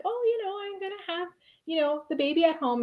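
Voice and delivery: high, soft, airy and carefree; breezy naive reply. Retold or imitated. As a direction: play it off lightly, high and soft. Her naive answer to the doctor.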